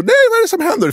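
put-on high-pitched panicked girlfriend; mock scream. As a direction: squeal it as the alarmed girlfriend. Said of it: "hon bara skriker liksom så här".

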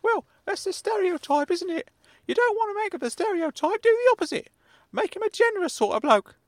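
kindly meddling uncle, high and loud; Uncle Claude voice. Acted. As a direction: pitch way up as a fussy elderly uncle.

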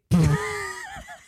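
shrieking laugh; high burst of laughter. Real, not acted. Recalling her bragging date, she bursts out laughing. Laughing at boob tape line.

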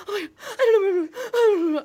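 high, loud, tearful mock panic; hyperventilating, imitated. Host imitates friend panicking at traffic stop, hyperventilating. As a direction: gabble it in a squealing fake panic. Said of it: "Summer starts hyperventilating".

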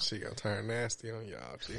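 whispered aside. Real, not acted. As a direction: whisper it to the audience. Aside to audience.

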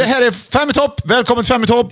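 high, excited announcer call; show announcer. Pretend. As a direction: launch the countdown show with big energy. Opens a mock list-show intro.

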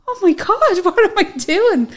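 high, loud "oh my God" of sudden panic; panicked exclamation. Retold or imitated. Retelling quitting her job, a high, loud burst of mock panic. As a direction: squeal it like you've just realised your mistake.

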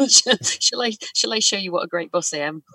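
ordinary delivery

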